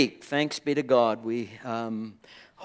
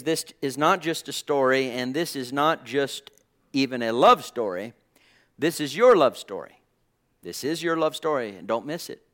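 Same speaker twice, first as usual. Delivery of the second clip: ordinary delivery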